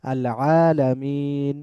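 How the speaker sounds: আল আলামিন with a long throat-squeezed vowel; drawn-out Arabic আলামিন, pharyngeal first sound; ain in আলামিন. Shown as a demonstration. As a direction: chant আলামিন slowly, pressing the ain. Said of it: "আইনের উচ্চারণটা আছে".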